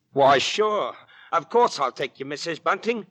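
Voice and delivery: put-on young suitor gladly agrees to escort her; eager agreement. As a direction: boom out a happy yes.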